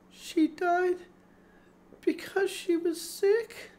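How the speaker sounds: plain reading voice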